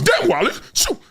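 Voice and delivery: high, excited imitation of roommate Pat; a hint of an envious teammate; imitating Pat. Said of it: "That's how he talk".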